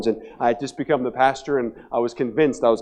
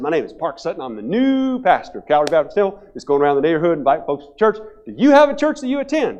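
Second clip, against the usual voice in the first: upbeat, louder door-to-door pitch, jumping high on the question; energetic young pastor; 4 semitones higher, 6 dB louder than usual. Retold or imitated. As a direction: sell it eagerly to a stranger at the door. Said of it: "I'm energetic".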